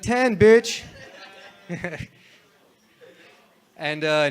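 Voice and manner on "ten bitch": raised, punchy retort; mock loud comeback. Guy answering the girl who rejected him, loud retort. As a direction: throw it back louder and higher.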